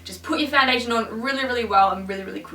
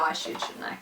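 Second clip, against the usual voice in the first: normal talking voice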